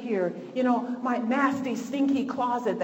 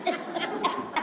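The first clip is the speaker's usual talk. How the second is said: laughing; 5 semitones higher, 6 dB quieter than usual. Real, not acted. Laughing about stinky diaper.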